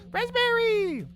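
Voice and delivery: high, loud crying call of a name; anguished cry. Acted. Friend crying out for Raspberry, high anguished wail.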